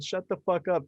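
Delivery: tossed-off imitation of yelling; mild mock yell. Yelling at noisy neighbors from the balcony, mild mock yell. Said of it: "So I just yelled".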